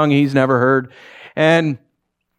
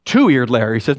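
ordinary delivery

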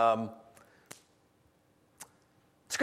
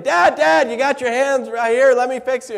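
higher, louder coaxing of a confused dad; patiently herd a wandering father, voice raised; loud pleading son; 10 semitones higher, 9 dB louder than usual. Retold or imitated. Son fussing over dad.

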